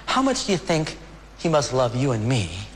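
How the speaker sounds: usual speaking voice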